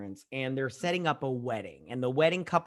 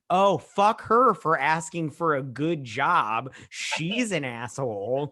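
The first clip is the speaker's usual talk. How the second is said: slow, drawn-out sarcasm, louder at the start; sarcastic mock outrage; 3 dB louder, slower than usual. Host sarcastically defends the fired assistant, mock outrage. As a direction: pretend to be scandalised, stretching each word. Sarcastic retort to the boss's firing.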